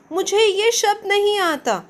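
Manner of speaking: high, thin little elf voice; thin squeak. Acted. Elf squeaking that he doesn't know the word. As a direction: pipe it up in a thin voice. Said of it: "अपनी पतली सी आवाज़ में".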